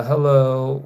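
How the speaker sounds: ordinary talk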